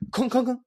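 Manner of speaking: high, hollow knocking noise; imitated clock-like knocking. Host describing the egg bouncing in silence, imitated knocks. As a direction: voice short knocks like a ticking clock.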